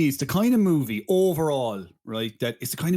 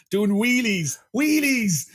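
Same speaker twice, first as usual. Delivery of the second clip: higher and louder with glee; excited; 9 semitones higher, 4 dB louder, much slower than usual. Real, not acted. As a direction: gush over the stunt. Laughing excitedly at stunt.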